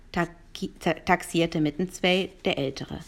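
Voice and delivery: usual speaking voice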